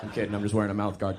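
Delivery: ordinary talk, nothing marked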